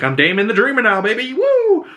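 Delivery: high, whooping parody of a boast; mock gleeful boast. Prince announcing his new dreamer self, mock gleeful whoop. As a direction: climb into a silly, high whoop.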